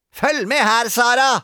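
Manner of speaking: high, loud grumpy shriek. A narrator's reading. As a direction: shriek it like a cross girl playing teacher. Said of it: "skrek Gretne Grete".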